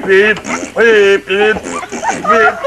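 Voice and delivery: loud beeping horn sounds; horn beeps. Retold or imitated. Storyteller honks as the bull farts, loud beeps. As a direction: honk each beep like a car horn.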